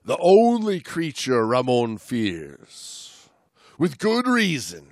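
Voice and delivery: slow, ominous read-aloud; dramatic reading. Acted. Host reading the moray passage to his co-host, ominously. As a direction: build dread around the moray. Reads aloud from a dive book.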